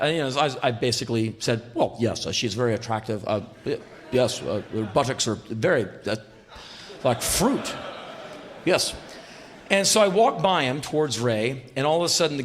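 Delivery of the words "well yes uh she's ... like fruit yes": halting, awkward mock-proper reply; uptight. Uptight man asked about a passing woman, stiff and halting. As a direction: stumble stiffly through an awkward compliment. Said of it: "I'm pretty uptight".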